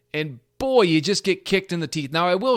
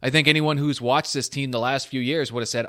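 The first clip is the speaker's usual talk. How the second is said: normal talking voice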